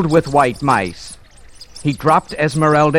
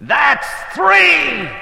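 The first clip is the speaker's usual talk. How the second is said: menacing shout; 11 semitones higher than usual. Acted. Magician's final warning.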